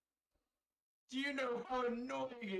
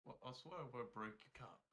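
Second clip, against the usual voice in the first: much lower, soft and fast; Jabba; 8 semitones lower, 11 dB quieter, faster than usual. Acted. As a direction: lean in and rumble it low. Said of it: "Jabba just kinda leans down and goes".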